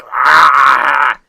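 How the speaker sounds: loud frustrated "ah"; frustrated shout. Real, not acted. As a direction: blurt out the frustration. Frustrated outburst.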